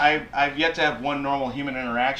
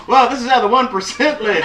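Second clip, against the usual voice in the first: loud, high mock amazement; hammed-up wonder; awed umbrella guest; 9 semitones higher, 9 dB louder than usual. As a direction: marvel loudly at sudden luxury. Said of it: "You're just like".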